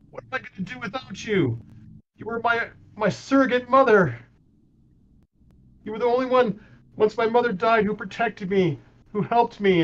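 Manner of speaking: lifted high in pitch, grief pushing each phrase; a girl pleading with her lost protector, half-crying; acted sobbing plea. [name] grieving over Lily, pleading through acted sobs. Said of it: "she's just gonna be sobbing hysterically".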